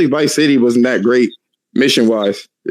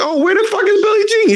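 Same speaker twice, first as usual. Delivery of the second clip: high squeaky outrage; mock surprise. Streamer recalling missing song, high squeaky disbelief. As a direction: shriek it in disbelief. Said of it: "I said".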